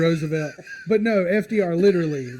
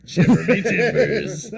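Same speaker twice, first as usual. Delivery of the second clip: laughing pirate voice; 3 semitones higher, 4 dB louder, slower than usual. Pretend. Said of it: "best pirate phrase".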